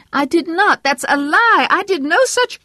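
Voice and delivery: a devout wife rebuking her husband's blasphemy; pious wife voice. A narrator's reading. Vera scolding Herb for blaspheming, prim and high.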